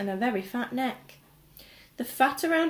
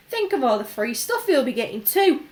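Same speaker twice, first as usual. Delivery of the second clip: envious; 3 semitones higher, 6 dB louder than usual. A narrator's reading. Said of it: "another boy said enviously".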